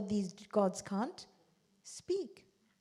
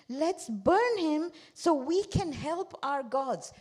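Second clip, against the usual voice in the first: slow mock angry cry; 5 semitones higher, 6 dB louder, much slower than usual. Crowd calls to burn Ibrahim.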